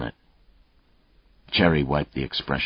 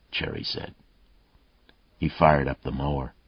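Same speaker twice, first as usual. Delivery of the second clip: usual speaking voice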